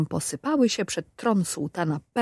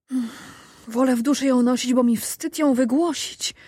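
put-on higher, fast embarrassed old woman; ashamed old woman; 6 semitones higher than usual. A narrator's reading. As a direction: rush it out in shame. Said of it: "odpowiedziała Maruda". (zawstydzona, szybko)

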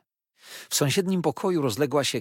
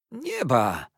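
higher-pitched cry of delighted surprise; joyful exclamation; 4 semitones higher, 4 dB louder than usual. A narrator's reading. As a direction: cry out on seeing a dear old friend. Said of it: "zawołał, ujrzawszy mnie".

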